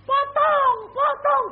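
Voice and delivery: high, loud yelled cut, cut; yelled command. Retold or imitated. Militia ordering to cut heads, high yell. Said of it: "Aku ya teriak gitu".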